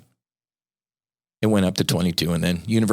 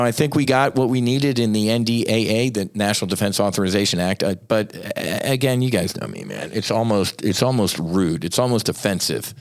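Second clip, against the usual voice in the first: normal talking voice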